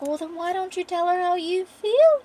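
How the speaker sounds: high, drawn-up teasing line; teasing prod. Acted. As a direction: needle a lovestruck friend, pitch climbing. Jasper teasing the lovestruck man.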